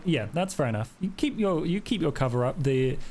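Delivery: ordinary delivery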